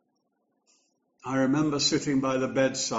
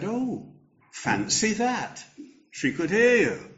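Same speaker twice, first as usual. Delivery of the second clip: put-on higher-pitched young nurse, slow and wondering on fancy that; young nurse amazed; 8 semitones higher than usual. Young nurse marvels to the vicar at the bedside, lifted high and wondering.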